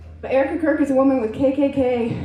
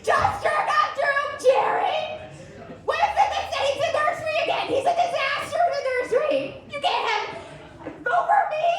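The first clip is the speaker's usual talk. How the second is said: laughing disbelief; 12 semitones higher, 3 dB quieter than usual. Real, not acted. Incredulous laughing rant.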